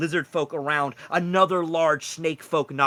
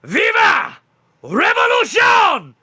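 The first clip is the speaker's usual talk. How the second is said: loud, much higher shouted slogan; possessed rally cry; 12 dB louder, slower than usual. Acted. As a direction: scream it like a fanatic. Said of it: "looks down upon you and goes".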